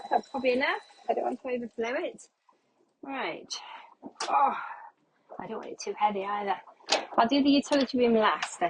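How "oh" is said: ordinary talk, nothing marked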